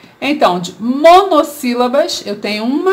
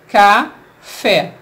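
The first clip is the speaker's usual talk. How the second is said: "Café" in two separate beats, ca, short pause, fé; syllable by syllable; 2 semitones lower than usual. Shown as a demonstration. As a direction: break "Café" cleanly between ca and fé. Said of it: "Quando eu separo".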